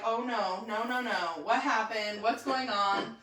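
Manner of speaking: soft string of wary worry; imitating worried husband. Wife imitates husband finding her at the sink, hushed worry.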